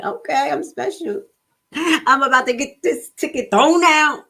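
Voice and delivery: high, smug and pleased with herself; mock cocky. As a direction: sell it like she's already won. Cocky retold inner voice.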